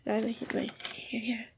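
soft unvoiced whisper. Real, not acted. Showing the crystal to viewers in a whisper. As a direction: breathe it close to the mic. Shows crystal close to mic.

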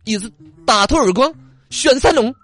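上海话骂人; Shanghainese scold. Retold or imitated. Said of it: "上海就不怎么撅起来了".

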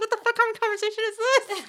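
high, loud, laughing disbelief; laughing yell. Retold or imitated. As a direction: shout it through laughter. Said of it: "We're like".

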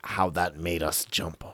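usual speaking voice

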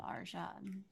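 very soft, hushed character voice. Acted. Player tries being in a very tall body, hushed character voice. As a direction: let it out quietly and haltingly, as the character. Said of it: "be able to practice Caleb's voice".

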